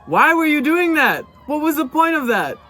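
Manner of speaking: high, loud disbelief; shocked why-would-you reaction; incredulous. Real, not acted. Reacting to silly fail video.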